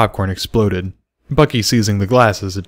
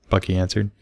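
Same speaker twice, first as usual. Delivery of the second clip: ordinary delivery, nothing marked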